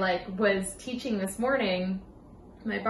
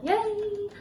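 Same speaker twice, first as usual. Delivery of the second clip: high sarcastic little cheer; mock cheer; 12 semitones higher, slower than usual. Teasing herself about exam study, mock cheer. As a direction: cheer for yourself, tongue in cheek.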